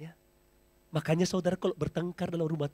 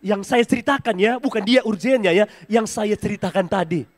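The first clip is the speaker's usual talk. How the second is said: emphatic; 5 semitones higher, 13 dB louder than usual. Real, not acted. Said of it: "Tolong jangan ditafsirkan".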